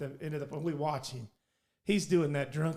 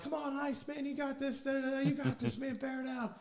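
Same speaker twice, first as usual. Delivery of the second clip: higher, softer infield chatter to the pitcher; mock coaching chatter; 9 semitones higher, 4 dB quieter than usual. Teammate cheering on the pitcher from left field, higher chatter. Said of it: "I'm like".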